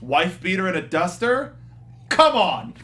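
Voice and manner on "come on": usual speaking voice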